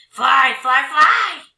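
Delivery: loud high troll chant; clamor it like eager trolls. Acted. Greedy trolls clamor for her to fly, loud. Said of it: "they clamored".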